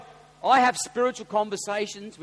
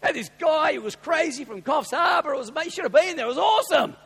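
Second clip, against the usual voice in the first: shouted, much higher gushing; excited; 11 semitones higher, 7 dB louder than usual. Shown as a demonstration. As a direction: burst with excitement about church. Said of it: "That's how we need to talk".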